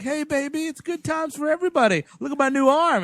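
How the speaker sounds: high party-guy voice; Brad Dourif. Acted. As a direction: pitch it way up, carefree reveler at the bar. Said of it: "Brad Dourif's being the voice of reason".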